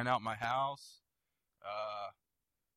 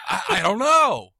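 much louder and higher, drawn-out protest; exasperated outburst; 9 semitones higher, 10 dB louder, slower than usual. Real, not acted. Co-host repeats himself as the other laughs, exasperated outburst. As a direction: protest it loudly, stretching the words.

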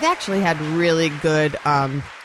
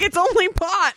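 high mocking guy voice; 13 semitones higher than usual. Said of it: "He's like".

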